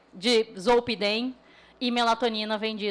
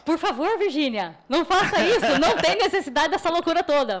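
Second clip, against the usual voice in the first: raised, pleading plea; 5 semitones higher, 4 dB louder than usual. Retold or imitated. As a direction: beg her not to do it. Retelling pleading with his wife.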